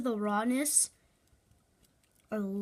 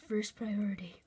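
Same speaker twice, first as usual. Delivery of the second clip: whispered aside; 5 semitones lower, 7 dB quieter than usual. Real, not acted. Trailing aside.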